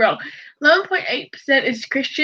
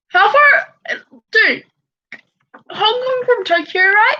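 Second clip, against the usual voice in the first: high, loud burst of disbelief; incredulous; 7 semitones higher, 3 dB louder than usual. Real, not acted. Reacting to how far Hong Kong is from Tokyo, incredulous. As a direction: pitch jumps up in amazement.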